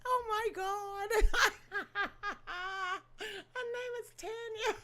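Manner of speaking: high, breathless giggling; can't stop cracking up; laughing. Real, not acted. Laughing to viewers about the judge's name.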